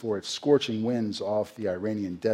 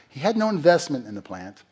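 normal talking voice

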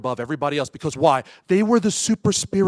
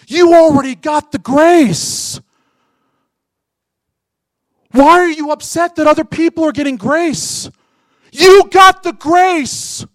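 much higher, louder and slow, every word driven home; full-force preaching; 13 semitones higher, 12 dB louder, slower than usual. Acted. Preacher thundering at grumbling believers, very loud and slow.

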